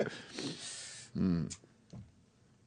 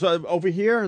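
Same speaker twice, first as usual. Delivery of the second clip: higher, mock-innocent imitation of a kid; 7 semitones higher than usual. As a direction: imitate your kid mocking you, pitched up. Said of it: "my kid mocks me and he goes".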